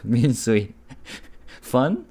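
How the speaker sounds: ordinary talk, nothing marked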